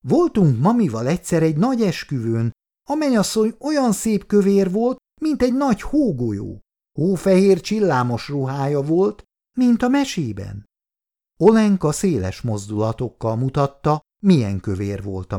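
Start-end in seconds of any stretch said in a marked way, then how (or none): 0.1-10.6 put-on high, excited child's storytelling; enthusiastic little girl. A narrator's reading. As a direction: tell it with a small girl's wonder. Child recalls a wedding. (lelkesen mesélő kislány)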